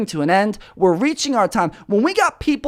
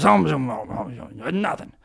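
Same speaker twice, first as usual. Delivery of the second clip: mumbled hesitant ums, fading much softer; mumbling; 4 semitones lower, 6 dB quieter than usual. Retold or imitated. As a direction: imitate a cornered guy mumbling an excuse. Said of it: "He's mumbling".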